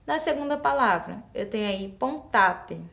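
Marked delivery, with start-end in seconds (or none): 1.9-2.8 "pontape" stressed on ta, with a weak ending; wrong stress on pontapé. Shown as a demonstration. Teacher reads the unaccented word, "pontape" stressed on ta. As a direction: say "pontape" as pon-TA-pe. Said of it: "a gente sabe que não existe".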